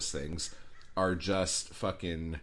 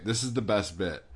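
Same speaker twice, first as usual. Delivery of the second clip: ordinary talk, nothing marked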